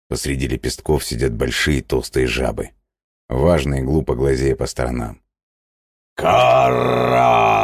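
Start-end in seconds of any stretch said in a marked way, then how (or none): 6.2-7.6 high loud toad cry; croaking toad shout. Acted. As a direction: bellow it like a fat toad. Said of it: "вдруг кричит одна".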